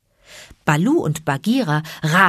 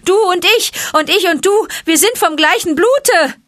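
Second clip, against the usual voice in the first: high, loud cry for help; calling for help; 13 semitones higher, 7 dB louder than usual. A narrator's reading. Mowgli crying up to the vulture for help, high and loud. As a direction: shout it up to the vulture. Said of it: "Mowglis Hilferuf".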